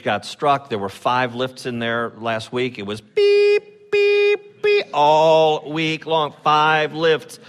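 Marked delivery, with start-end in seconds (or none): 3.0-4.6 high mock beeping of a lift. Pastor mimics the construction lifts beeping, high mock beep. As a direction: squeak out the lift's backup beep.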